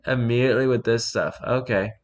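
usual speaking voice

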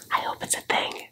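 hushed whisper under the breath; whispered aside. Real, not acted. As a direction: whisper it like a secret hope. Conspiratorial aside after "don't worry.

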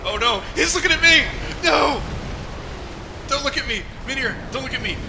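high frantic shrieking; panicked scream. Real, not acted. Panicking as boss targets him.

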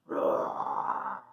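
deep rough breathy roar; dinosaur roar. Shown as a demonstration. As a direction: roar like a T-Rex. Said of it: "can you make the sound".

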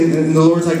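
ordinary delivery

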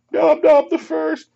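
very high, loud, drawn-out cry; high mock shout. Game table cheering on Nob Nob, high hammed-up shout. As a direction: shout it high and slow, in character.

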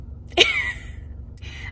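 soft breathy laugh through tears; embarrassed teary laugh; tearful laugh. Real, not acted. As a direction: laugh at herself while crying.

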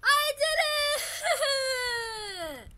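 high, loud, long-held victory cry; triumphant scream. Real, not acted. Gamer who just beat the boss, triumphant screaming.

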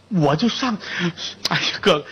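plain reading voice

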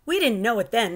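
plain reading voice

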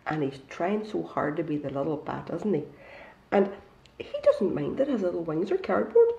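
plain reading voice

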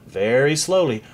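usual speaking voice

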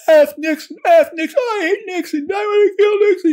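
high, loud mock scream of rage; retold enraged screaming. Student raging at Nixon in the brigade room, imitated furious scream. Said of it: "screaming at the top of his lungs".

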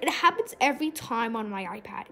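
ordinary talk

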